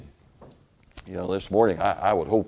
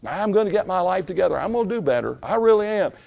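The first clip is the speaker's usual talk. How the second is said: mock self-righteous vow; 12 semitones higher, 4 dB louder, faster than usual. Mocking self-righteous promises.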